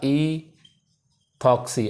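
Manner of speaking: "ईथॉक्सी" very slowly, first sound held, then a gap; slow syllable split. Shown as a demonstration. Teacher giving students the two-carbon name, "ईथॉक्सी" slowly in separated parts. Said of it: "तो इसे क्या बोलूँगा".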